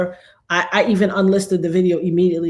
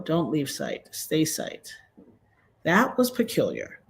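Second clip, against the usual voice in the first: ordinary talk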